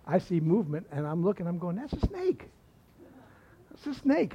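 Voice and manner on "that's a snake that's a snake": high, soft little exclamation; hushed high-pitched mock surprise; retold discovery. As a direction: play a kid spotting something, pitched way up. Boy spotting a snake, retold.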